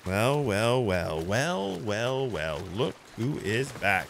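low, drawn-out villain welcome; villain gloat. Pretend. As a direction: greet the enemy like a smug movie villain. Villain greeting an enemy ship.

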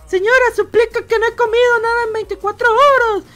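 high, loud mock-pitiful begging voice; pleading beggar. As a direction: beg her like a starving man. Beggar pleading to old lady.

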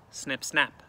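plain reading voice

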